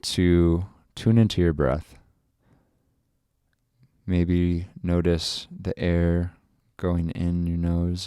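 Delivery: unhurried, soothing relaxation voice; guided meditation. Acted. Leads a breathing meditation.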